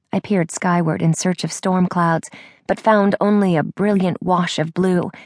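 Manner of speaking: ordinary delivery, nothing marked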